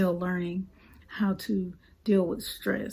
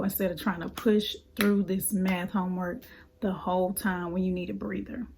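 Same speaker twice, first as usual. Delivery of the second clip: ordinary talk, nothing marked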